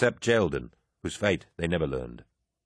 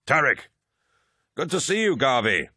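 calling out; 7 semitones higher than usual. A narrator's reading. Calling out across battle.

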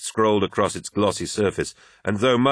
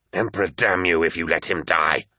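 harsh, hissed curse. A narrator's reading. First Captain threatens the apothecary, hissed. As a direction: hiss it through tight fury without raising the pitch. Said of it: "hissed Abaddon".

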